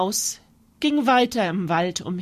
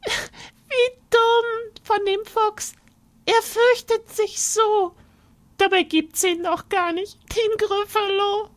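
a tiny mouse laughing at the fooled fox; gloating mouse; 13 semitones higher than usual. Acted.

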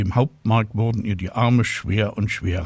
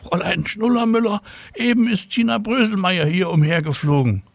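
put-on much higher tattling child voice, "Fräulein Schnullermüller" called out; schoolboy calling out; 10 semitones higher than usual. A narrator's reading. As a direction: pupil calls to the teacher eagerly. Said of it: "Mirko Rüpel rief". (Schüler ruft der Lehrerin petzend zu, viel höher)

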